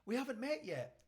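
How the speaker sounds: high-pitched, chirpy doorstep greeting; imitated cheery neighbour; friendly neighbour. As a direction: introduce yourself in a high, neighbourly voice. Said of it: "says the neighbour".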